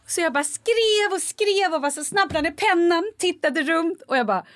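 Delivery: ordinary talk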